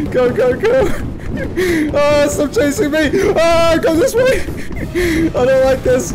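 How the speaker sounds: high panicked pleading; being chased. Real, not acted. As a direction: flee in high-pitched panic. Fleeing Huggy Wuggy.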